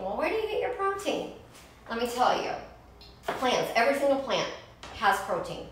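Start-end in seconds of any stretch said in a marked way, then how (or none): 0.0-1.3 mocking curious viewer. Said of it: "you guys are always asking".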